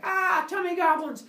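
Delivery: loud, high comic panic; freaking out. Acted. Kronos sees what he puked up, hammed-up freak-out. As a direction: shriek it in mock alarm. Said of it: "Kronos freaks out".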